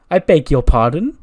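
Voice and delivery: acted haughty disbelief; affronted. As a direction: snap back as an offended diva. Charlotte snaps round at Tatum.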